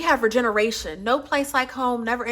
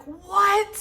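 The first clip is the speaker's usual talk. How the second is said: mock shocked what; 10 semitones higher, 4 dB louder than usual. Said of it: "I just be like".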